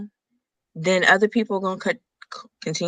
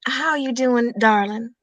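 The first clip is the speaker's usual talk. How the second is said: Southern drawl impression; 6 semitones higher, slower than usual. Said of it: "do that deep sa-, you, deep South, just slow".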